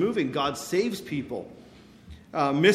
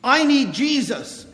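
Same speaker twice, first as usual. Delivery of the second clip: heartfelt cry; 6 semitones higher, 5 dB louder than usual. Retold or imitated. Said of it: "her heart said".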